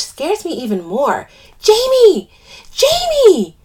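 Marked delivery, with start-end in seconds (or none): 1.6-3.6 high, loud frantic call; screaming. A narrator's reading. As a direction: scream the name over someone's crying. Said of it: "I scream over her cries".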